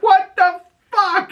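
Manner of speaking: slow, very high-pitched disbelief; mock shock. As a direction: stretch out an appalled, high imitation. Acting shocked reaction to sudden shooting.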